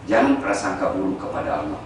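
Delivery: normal talking voice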